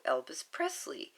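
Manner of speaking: plain reading voice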